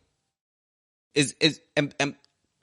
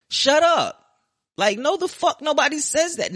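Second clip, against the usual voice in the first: exasperated; 10 semitones higher than usual. Real, not acted. Exasperated at imaginary pundits.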